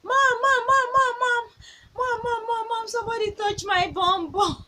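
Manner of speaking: put-on high, loud little girl calling for her mom; a mother imitating her little daughter shouting for her; excited child calling. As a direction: call out like an excited three-year-old running to her mom. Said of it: "She was shouting".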